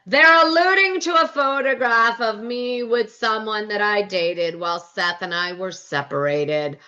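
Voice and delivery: slow, drawn-out put-on voice, falling in pitch; stretched-out mimicked delivery; Meredith. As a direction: imitate a slow, deliberate explanation. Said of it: "Meredith pipes up and says".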